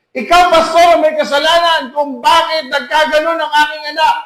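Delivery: retold yell at the pastor; imitating a furious parent blaming the pastor; angry accusing parent. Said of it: "Nagagalit, sinisigawan ako".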